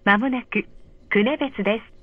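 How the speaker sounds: ordinary talk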